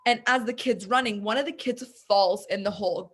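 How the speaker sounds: normal talking voice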